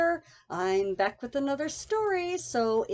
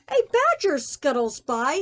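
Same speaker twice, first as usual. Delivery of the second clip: ordinary delivery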